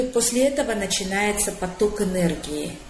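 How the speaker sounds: ordinary talk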